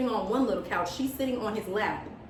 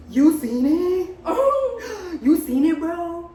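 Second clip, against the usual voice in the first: gasping, slowed, higher and much louder, half laughing; shocked gasping disbelief; 7 semitones higher, 5 dB louder, slower than usual. Retold or imitated. Roommate caught with her boyfriend, asking in gasping shock. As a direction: catch her breath in shock, then blurt it out. She turns around and she goes.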